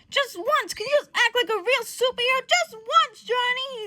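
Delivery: high, loud mock pleading; hammed-up rant, rising in frustration; exasperated scolding. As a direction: act out a fed-up teammate begging for maturity. Retold exasperated scolding of Johnny.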